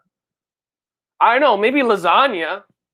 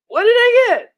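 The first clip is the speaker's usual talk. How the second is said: the same question again, squeaked up very high; high squeaky repeat; 4 dB louder than usual. Pretend. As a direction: squeal it like a cartoon character.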